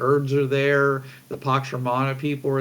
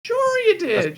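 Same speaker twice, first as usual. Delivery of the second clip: sarcastic disbelief; 3 dB louder than usual. Acted. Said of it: "rescuers disbelieve".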